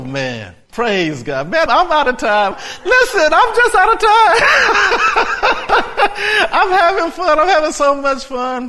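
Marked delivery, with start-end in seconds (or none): 1.5-4.4 high, loud exclamation rising into laughter; excited shouting. Real, not acted. Preacher exclaims, still worked up. 4.4-6.5 high, loud burst of laughter. Real, not acted. 6.5-8.7 high, loud delight coming down from laughter; elated shouting. Real, not acted. Elated preacher after laughing.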